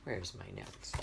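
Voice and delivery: very soft, unvoiced mumble; muttering to self. Real, not acted. Teacher hunting for notes, muttering to self. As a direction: mumble it to yourself, barely audible.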